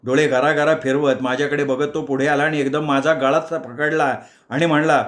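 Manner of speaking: ordinary delivery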